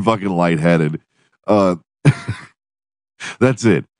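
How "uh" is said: short laugh. Real, not acted. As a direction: chuckle mid-sentence. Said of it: "already laugh".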